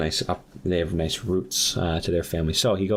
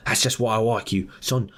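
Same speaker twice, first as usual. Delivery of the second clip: higher, eager Aussie voice; Australian accent; 4 semitones higher than usual. Acted. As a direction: pitch an offer in a put-on Australian accent. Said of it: "he's clearly Australian".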